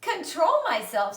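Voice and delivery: high, loud incredulous echo; indignant outburst. A narrator's reading. Fern to her father, loud indignant disbelief. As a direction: throw his words back at him. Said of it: "said Fern".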